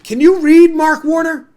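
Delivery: high, loud exasperated tirade; outraged yelling. Real, not acted. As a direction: shout in disbelief at a hypocrite. Outraged rant addressed to Warner.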